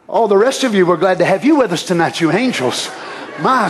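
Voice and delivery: usual speaking voice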